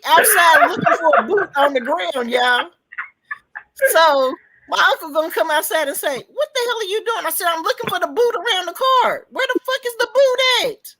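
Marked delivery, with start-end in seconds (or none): 6.2-10.9 higher-pitched, baffled retelling; comic exasperated quote, pitched up; retold exasperation. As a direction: re-enact the bewildered back-and-forth. Acts out baffled exchange with uncle.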